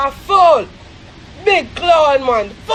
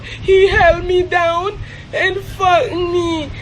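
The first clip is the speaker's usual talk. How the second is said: slow, drawn-out imitation sobbing; put-on weepy storytelling; mock crying vlogger; slower than usual. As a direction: mimic a tearful vlogger, dragging out the words.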